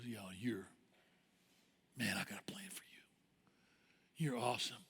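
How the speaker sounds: hushed, low, near-whispered encouragement; Jesus. Acted. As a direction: confide it slowly and very softly. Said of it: "Jesus comes and looks at you and goes".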